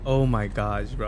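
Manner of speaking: ordinary talk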